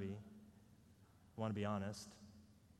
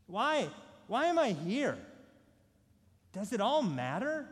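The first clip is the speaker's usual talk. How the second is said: urgent questioning; 5 semitones higher, 3 dB louder than usual. Acted. Rhetorical big questions.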